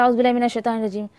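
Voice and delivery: गबbled fast औज़ु बिल्लाहि, letters swallowed; careless rushed recitation. Shown as a demonstration. Teacher mocking students who recite carelessly, rushed mumble. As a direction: rush through it mindlessly. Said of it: "रस्मी तौर पे मत पढ़िए".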